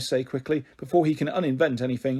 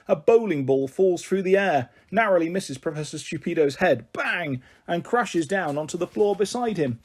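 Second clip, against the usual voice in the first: ordinary delivery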